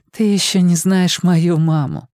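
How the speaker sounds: put-on small tired girl answering; girl's voice. Irene replies weakly smiling.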